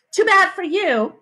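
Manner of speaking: high, slow teasing dig; taunting retort. Acted. Sheila won't give up the rocks, high drawn-out taunt.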